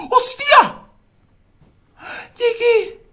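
very high, loud cry of relief; triumphant shout. Real, not acted. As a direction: shout with joy. Finally reached shrine.

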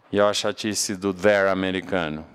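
ordinary talk

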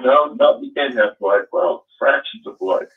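usual speaking voice

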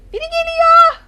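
loud high yell of alarm; panicked yell. Real, not acted. Gamer warns teammates someone is coming, panicked yell. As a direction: warn everyone, terrified. Chased in horror game.

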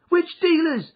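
high, loud urgent question; urgent demand. A narrator's reading. Jem pressing his sister about the dealers, high and urgent. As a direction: snap it out in panic.

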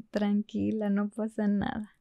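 calm yourself like a tiny conscience; Pepe Grillo. Retold or imitated. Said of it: "Y Pepe Grillo". (Pepe Grillo en voz baja)

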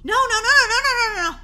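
high, loud, laughing string of no's; panicked no's. Acted. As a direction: rattle off the no's in mock panic. Panicking about zombie.

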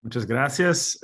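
ordinary talk

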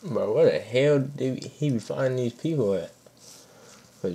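usual speaking voice

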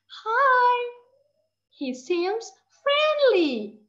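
put-on high, bright child voice; lightly voiced boy; boy's line. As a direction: voice the eager boy. Teacher voicing a boy in story.

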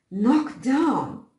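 high, loud, shocked "Knocked down!"; shocked exclamation. A narrator's reading. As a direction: gasp it out in disbelief. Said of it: "exclaimed Missus Dorgan".

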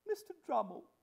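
very high, haughty address; imperious lady. Acted. Hostess addressing Mister Drummle, imperious. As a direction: summon the guest grandly, pitched high. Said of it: "said Missus Pocket".

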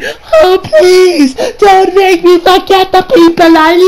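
high, wailing fake plea; hammed-up tearful pleading; mock begging. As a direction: plead in a squeaky mocking cry.

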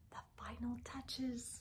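a soft hushed whisper; whispered narration. A narrator's reading. A reader describing the teacher's final touches, whispering. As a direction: hush as if it's a secret.

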